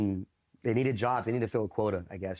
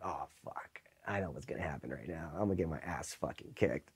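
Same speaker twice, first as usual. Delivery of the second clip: much lower, much softer, far-off dread; dreading mutter; 6 semitones lower, 9 dB quieter than usual. Retold or imitated. Recruit seeing his enemy arrive, low dreading mutter. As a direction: mutter it like you know you're done. Dread as drill instructor enters.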